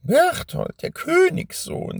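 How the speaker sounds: high, icy witch voice; frosty voice. A narrator's reading. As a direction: say the name coldly, icy. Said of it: "mit frostiger Stimme". (frostige Hexenstimme)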